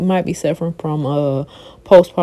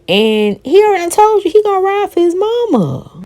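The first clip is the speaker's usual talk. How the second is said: high, raised voice telling her off; exasperated callout; 12 semitones higher than usual. Acted. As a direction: scold her with high-pitched disbelief. Telling [name] off directly.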